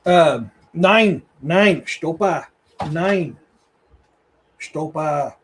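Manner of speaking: loud Norse commands to the dogs, nine and Stopa drawn out. Shown as a demonstration. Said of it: "Is that German?".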